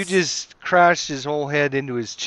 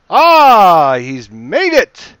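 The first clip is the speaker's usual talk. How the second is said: high, drawn-out cry falling away; slow, loud burst at the result; loud exclamation; 5 semitones higher, 13 dB louder, much slower than usual. Real, not acted. Game master announces the kobold made the roll, big loud exclamation.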